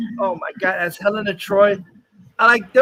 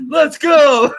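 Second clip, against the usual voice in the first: loud, higher "Let's go!" from the army; mock army shout; 5 semitones higher, 7 dB louder than usual. Mocking the movie army's battle cry with a shout. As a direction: yell "Let's go!" like a whole army in mockery. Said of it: "the army wanted".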